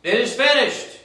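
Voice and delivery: "It is finished" cried out high and loud; loud cry. Acted. Jesus crying out from the cross, loud and high. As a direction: call it out like a cry of victory. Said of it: "cried with a loud voice".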